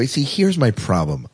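normal talking voice

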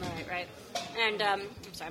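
talking while chewing; words around a bite of food; mouth full. Real, not acted. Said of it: "I'm chewing 'cause I'm starving".

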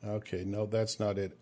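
ordinary talk

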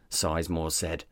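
usual speaking voice

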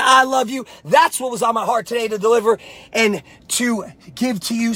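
ordinary talk, nothing marked

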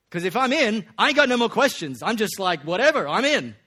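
loud, high, giddy relief; excited. Real, not acted. Preacher imagining getting into heaven, loud and giddy. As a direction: blurt it out, thrilled to be in. Excited punchline, much louder.

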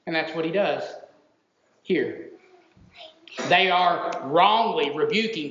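ordinary talk

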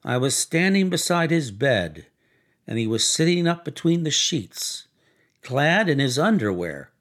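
plain reading voice

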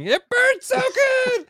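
squeaky falsetto, drawn out slowly; high squeaky voice. Pretend. Joking about Squid burning.

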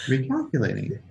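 soft, even, unbothered robot voice; quiet machine patience; calm GPS voice. Retold or imitated. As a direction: say it very calmly, no judgement. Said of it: "It just very calmly goes".

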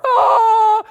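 sudden high shouted wail; a loud fake funeral wail from the car; mock wail. Shown as a demonstration. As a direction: let out a hammed-up mourning cry. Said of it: "you could be mourning and wailing in your car".

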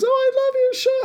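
high, fast fan gush; imitating gushing fan.

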